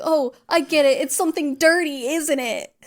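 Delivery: high, loud goofy voice; a silly high voice as Nash gets the joke; dim realization. Retold or imitated. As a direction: play a clueless cop finally catching on. Voicing a dim cop catching on.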